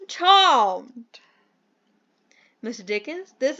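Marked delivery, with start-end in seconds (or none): 0.1-1.2 louder, lightly hammed-up British gent; bad British accent. Mentor meets the green friend, mock British accent. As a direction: greet them as a pompous British gent. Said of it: "do the worst British accent".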